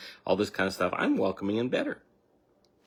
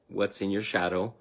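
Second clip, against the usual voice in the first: usual speaking voice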